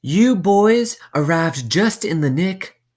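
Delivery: high, slow, stern warden voice; warden's voice. Acted. Warden speaking, character voice.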